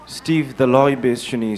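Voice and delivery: ordinary delivery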